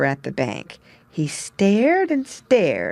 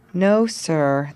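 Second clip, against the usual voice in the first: ordinary delivery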